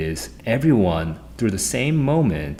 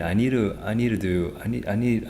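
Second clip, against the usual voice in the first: usual speaking voice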